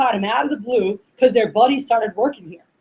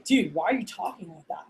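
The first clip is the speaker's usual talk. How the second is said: low, much softer mock disbelief; puzzled aside; 5 semitones lower, 12 dB quieter than usual. Friend reacting to an odd call, low and baffled.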